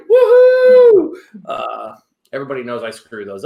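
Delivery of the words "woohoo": a high, loud woohoo of self-congratulation; triumphant cheer. Real, not acted. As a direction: celebrate getting the name right, all out. Cheering after saying a name right.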